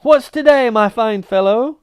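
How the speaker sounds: loud high call down the street. Acted. Scrooge calling to a boy in the street, loud. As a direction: hail the lad below.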